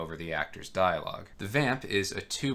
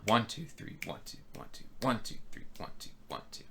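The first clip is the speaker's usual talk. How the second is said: each ONE voiced, the rest of the count whispered; counting with accents; 3 semitones higher, 5 dB quieter than usual. Shown as a demonstration. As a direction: lean on every ONE, breathe the other numbers. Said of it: "emphasizing the right notes".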